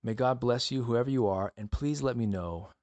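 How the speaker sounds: ordinary delivery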